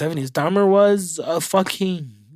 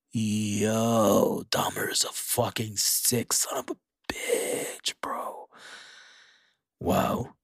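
appalled whisper; 8 semitones lower, 9 dB quieter, slower than usual. Real, not acted. Appalled aside about Dahmer.

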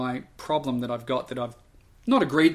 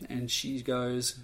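ordinary talk

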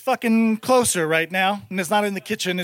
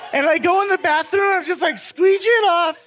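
normal talking voice

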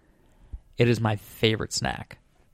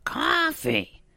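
very high cartoon mom falsetto; Marge; 10 semitones higher, much slower than usual. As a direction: correct him in a strained high housewife voice. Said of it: "And she's like".

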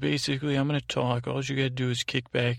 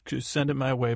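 usual speaking voice

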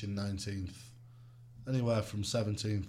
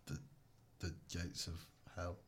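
quiet; 5 semitones lower, 14 dB quieter than usual. Acted. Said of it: "Do the Queen".

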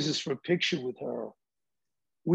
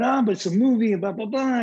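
higher and louder, pleading with the doorman; pleading protest; 5 semitones higher, 6 dB louder than usual. Retold or imitated. Arguing with the restaurant doorman, raised excited plea. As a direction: push back eagerly, arguing your way in. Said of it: "And I'm going".

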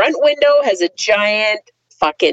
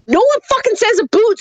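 exasperated; 10 semitones higher than usual. Real, not acted. Exasperated rant against accent stereotype.

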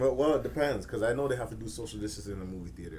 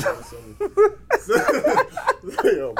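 laughing; 10 dB louder than usual. Real, not acted. Laughing about tea.

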